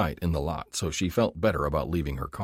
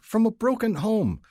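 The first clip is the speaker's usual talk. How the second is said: whining; 3 dB louder than usual. A narrator's reading. Rookie whining to his partner about the drunk. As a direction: whine for sympathy. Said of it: "the rookie whined".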